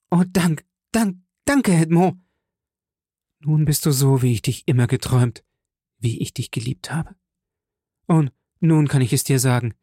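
a touch of overflowing gratitude; grateful joy. Acted. Mercedes thanks Edmond for sparing her son, joyful.

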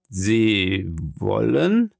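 very slow, stretched incredulous question; taken-aback challenge. Acted. Journalist questioning his friend's demand, slow disbelief. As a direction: draw out both words, rising in disbelief.

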